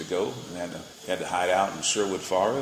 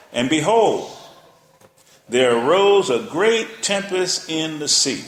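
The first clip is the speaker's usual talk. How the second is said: higher, louder and slow; dramatic scripture reading; 5 semitones higher, 5 dB louder, slower than usual. Acted. Preacher painting the storm for the congregation, loud and slow. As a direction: build the storm with the voice.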